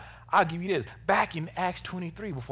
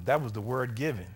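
ordinary talk, nothing marked

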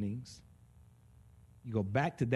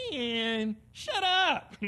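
cranky soul; 9 semitones higher, much slower than usual. Retold or imitated. Soul snapping at the spirit to hush, high and sharp. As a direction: snap it off high like a grumpy inner voice. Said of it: "and my soul goes".

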